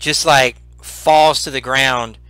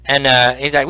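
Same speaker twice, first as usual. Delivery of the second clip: ordinary delivery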